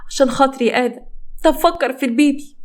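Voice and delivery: high, imploring character voice; pleading. Acted. Wife imploring husband for the baby's sake, high pleading.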